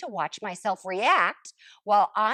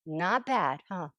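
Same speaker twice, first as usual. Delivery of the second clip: usual speaking voice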